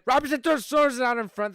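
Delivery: excited call. Acted. Streamer calling a shot on goal for viewers, excited rising call. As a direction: ramp up as the shot comes. Scoring chance in play-by-play.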